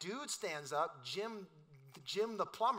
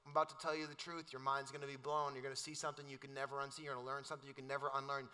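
hint of Morpheus; 5 semitones lower, 5 dB quieter, faster than usual. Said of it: "and he says".

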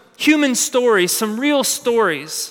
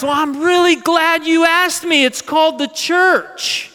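proud reveal; 6 semitones higher than usual. Acted. Proud church reveals its name.

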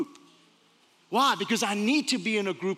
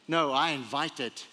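usual speaking voice

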